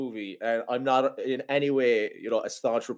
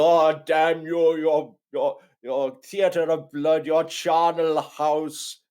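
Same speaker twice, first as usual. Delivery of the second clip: slow, higher-pitched hammed-up declamation; overwrought; 4 semitones higher, 3 dB louder, much slower than usual. Imitating the film's surgery scene, overwrought. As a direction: draw out each word in mock anguish. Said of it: "it's that overwrought scene where he's go".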